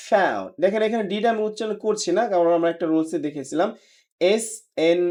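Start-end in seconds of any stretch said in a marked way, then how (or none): 0.1-0.5 American "found" with AE-oo and dropped D; American accent. Shown as a demonstration. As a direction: say "found" as falling FAE-oon. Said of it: "ওরা বলছে". (American উচ্চারণে)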